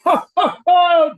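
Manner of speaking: loud, high burst of laughter; big scoffing cackle; mocking laugh. Real, not acted. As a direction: laugh loudly at a silly opinion. Scoffs at the opinion.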